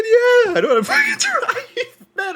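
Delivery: very high, loud, drawn-out squeal; hammed-up squealing protest. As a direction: squeal it through laughter, mock insistence. Hammed-up claim that he can drive.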